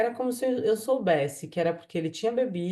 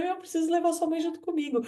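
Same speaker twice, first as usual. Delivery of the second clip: urgent plea; 10 semitones higher, faster than usual. Retold or imitated. Urgent plea during dream emergency.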